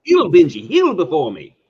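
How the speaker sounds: loud, mock-villain order to a dog; dog command. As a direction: call a dog to heel, hammed-up. Villain line turned dog command.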